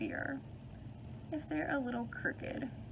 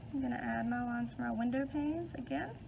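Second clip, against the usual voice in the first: normal talking voice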